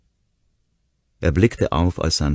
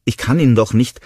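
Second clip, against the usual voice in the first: pleading protest; 9 semitones higher, 8 dB louder than usual. A narrator's reading. Will protesta.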